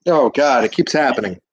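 ordinary delivery

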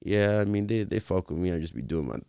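deadpan mock interviewee, slowing at the end; Angus Cloud. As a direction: shrug it off with no energy, mimicking him. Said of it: "Monotone as hell".

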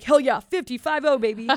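a dad bragging about turning fifty, loud and loose; dad's whoop. Retold or imitated. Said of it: "my dad would be like".

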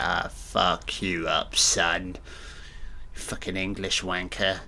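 lightly done gruff hard-man voice, dropped down low; Rutger's reply. Retold or imitated. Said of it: "Rutger's like".

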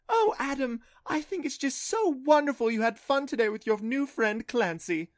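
high gushing mother imitation; sing-songy mom voice. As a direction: gush like a doting mom. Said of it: "imitating his mom's sing-songy voice".

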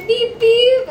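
high loud horn noise; horn beep. Retold or imitated. Mimicking a car hitting someone, loud horn beep.

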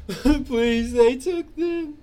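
high, loud, slow sobbing cry; sobbing plea. Acted. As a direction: wail the plea out slowly. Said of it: "starts sobbing".

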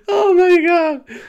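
high, slow, wheezy with laughter; weak from real laughing; overcome with laughter. Real, not acted. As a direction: gasp it out at the end of a laughing fit. Recovering from laughing fit.

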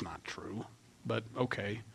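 unvoiced sceptical whisper; a wry whispered but okay; whispered aside. Real, not acted. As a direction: drop to a dry whisper aside. Aside doubting Peter's claim.

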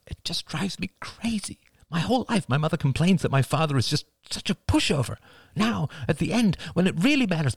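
high-pitched, distressed woman's voice; upset daughter venting about parents; agonized. A narrator's reading. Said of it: "Cassie's voice was agonized".

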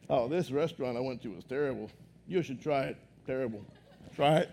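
low, soft, deadpan with a laugh breaking through; flat, joyless recommendation. Shown as a demonstration. Pastor showing how unenthusiastic praise sounds, low deadpan voice. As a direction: recommend it with no excitement at all.

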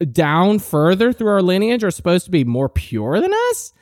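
ordinary delivery

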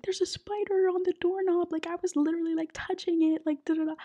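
high-pitched imitation of a spider freak-out; mock panicked roommate. Roommate panicking about spider on phone.

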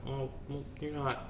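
dry murmur. Retold or imitated. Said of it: "I'm like".

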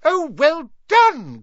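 very high, loud squeaky praise; delighted cry. A narrator's reading. Flitwick praising Hermione with a high delighted cry. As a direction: squeal with delight like a tiny professor. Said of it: "cried Professor Flitwick".

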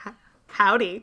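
playful Texas howdy; Texas accent. Pretend. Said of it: "That's my Texas introduction".

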